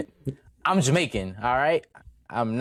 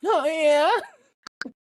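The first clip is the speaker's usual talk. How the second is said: high silly voice; 4 dB louder than usual. Pretend. Echoing the silly voice.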